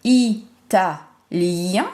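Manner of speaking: "Italien" slowly, i, ta, liiien; split into syllables. Shown as a demonstration. Teacher isolating a syllable for pupils, "Italien" slowly segmented. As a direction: pause between syllables and stretch the end of "Italien". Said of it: "La syllabe où j'entends le son I".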